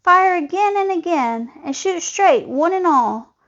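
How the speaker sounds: high-pitched hunter's orders; calling out. A narrator's reading. Hunter orders everyone to fire at the moose, calling out. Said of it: "heard a voice call".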